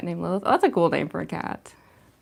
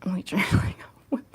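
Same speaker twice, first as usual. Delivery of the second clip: unvoiced concentrating whisper. Real, not acted. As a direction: whisper while focusing.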